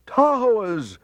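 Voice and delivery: high, loud panicked cry; crowd crying out. A narrator's reading. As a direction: shout the alarm back. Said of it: "they cried".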